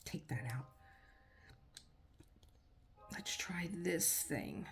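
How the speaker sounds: whispered to herself; a quiet aside while working. Real, not acted. Crafter whispering to herself while swapping tips.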